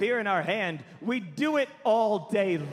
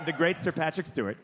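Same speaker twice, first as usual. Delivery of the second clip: normal talking voice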